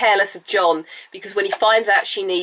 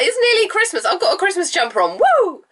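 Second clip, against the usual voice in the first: excited; 10 semitones higher than usual. Real, not acted. Christmas jumper cheer.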